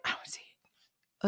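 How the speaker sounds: slow retold whisper; very soft, voiceless aside; hushed searching. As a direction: whisper it slowly while peering into darkness. Retells peering into the dark.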